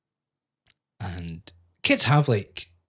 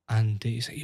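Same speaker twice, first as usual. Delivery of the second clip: ordinary talk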